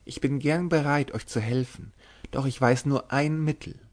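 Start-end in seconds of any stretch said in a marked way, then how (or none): none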